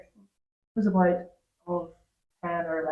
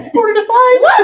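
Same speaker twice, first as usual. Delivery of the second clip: high, loud shocked exclamation; surprised; 11 dB louder than usual. Real, not acted. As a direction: gasp out the time in shock. Shocked at the time.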